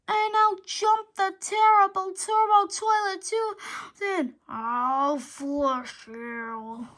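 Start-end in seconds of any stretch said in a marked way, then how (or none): 4.5-6.9 very slow, low villain growl; monster threat. Acted. Turbo Toilet threatening the hero, slow low menace. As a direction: menace him like a huge toilet beast.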